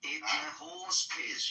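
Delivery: mock Aussie.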